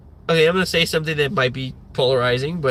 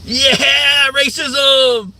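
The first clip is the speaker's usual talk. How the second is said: high, loud mock cheer; mock enthusiastic yell; cheering dumb fan; 9 semitones higher, 5 dB louder, much slower than usual. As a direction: whoop it like a clueless fan. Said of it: "someone in the peanut gallery".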